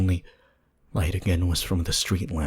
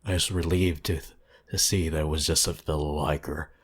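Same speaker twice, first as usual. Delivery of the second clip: usual speaking voice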